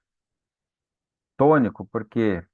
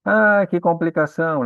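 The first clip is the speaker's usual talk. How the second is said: playful exasperation; 7 semitones higher, 5 dB louder than usual. Pretend. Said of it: "mock exasperation".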